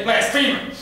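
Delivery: sudden high, loud outcry; accusing shout. Acted. Daddy mishears Jesus, loud accusing shout. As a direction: burst out with the condemnation. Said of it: "He kept mishearing what Jesus had to say".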